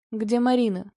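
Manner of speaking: ordinary delivery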